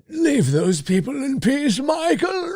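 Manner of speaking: stern shouted warning, high and slow; Doctor Loomis. Retold or imitated. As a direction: call out like the doctor. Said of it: "Doctor Loomis is approaching the gas station".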